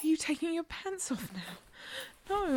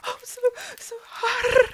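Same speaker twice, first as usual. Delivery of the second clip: loud high moan; 6 semitones higher, 6 dB louder than usual. Acted. Louder, higher moan.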